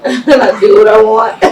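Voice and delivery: real laugh breaking into the line; amused. Real, not acted. Guest owning up to being blunt, laughing.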